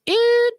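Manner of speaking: very high, short "eep" tone; imitated machine beep. Voicemail cutting off the caller, high imitated beep. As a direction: mimic an answering machine's beep. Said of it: "And then it's like".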